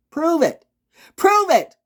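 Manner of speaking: loud, high and climbing toward a shout; escalating demand. Acted. Speaker demanding proof from accusers, escalating yell. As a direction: push each demand harder.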